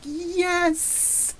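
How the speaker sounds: high, breathy triumphant yes with a laugh; joyful yes. Retold or imitated. Vlogger describing his heart at the scene, high joyful yes. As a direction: cheer it like your heart leaps. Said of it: "my heart was just like".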